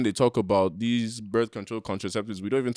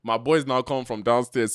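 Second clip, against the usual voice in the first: ordinary talk, nothing marked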